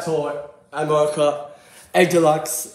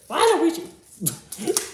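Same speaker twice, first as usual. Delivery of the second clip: high, very slow drawn-out "Waluigi!"; excited call; 13 semitones higher, much slower than usual. Real, not acted. Kid gamer cheering at finding Waluigi, excited. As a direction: stretch the name out in delight. Shouts character name excitedly.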